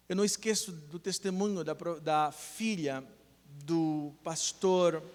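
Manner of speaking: ordinary talk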